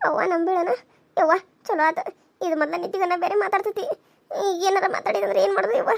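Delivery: soft, quick worried aside; wife murmuring aside to husband; hushed aside. Acted.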